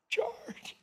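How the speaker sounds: whispered echo of the shout; fading echo. Pretend. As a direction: let it die away like an echo down a hall. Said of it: "echo down the corridor of time".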